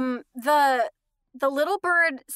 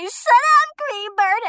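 put-on high, loud child's shout; toddler yelling; 10 semitones higher, 7 dB louder, much slower than usual. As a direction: yell it like an upset toddler. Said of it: "I would say".

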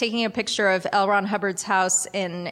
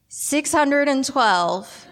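deadpan guess; 6 semitones higher, 3 dB louder, much slower than usual. Retold or imitated. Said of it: "so I said".

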